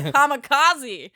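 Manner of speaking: kamikaze stretched out high and loud; eager order. Retold or imitated. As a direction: order it like it's a great idea. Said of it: "And I was like".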